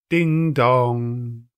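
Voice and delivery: ding dong rung out loud and long like a clock bell; chiming bell. A narrator's reading. Narrator ringing out the clock chime, loud and sustained. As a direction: sound it like the tolling bell.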